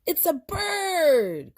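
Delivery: high, slow amazed cry; excited cry. Acted. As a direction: cry out in wonder. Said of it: "cried Miguel".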